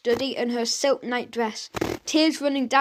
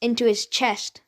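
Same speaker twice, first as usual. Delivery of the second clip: usual speaking voice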